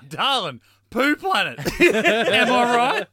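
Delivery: high, loud, laughing through the words; laughing mock reply. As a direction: giggle it out as a goofy husband. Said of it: "He's like".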